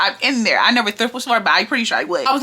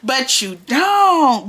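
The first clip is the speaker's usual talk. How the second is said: slow, stretched "you don't" to call out a bluff; slow sassy denial to a coworker who brags about bags; drawn-out mocking denial; much slower than usual. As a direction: drag it out, savouring the callout.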